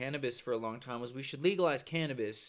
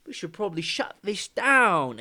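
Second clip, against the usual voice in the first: put-on high, loud muddled British accent; bad British accent; 6 semitones higher, 8 dB louder than usual. British deciding to end the scheme, bad British accent. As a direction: overdo a dubious British accent, loudly. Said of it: "I don't know what fucking accent that is".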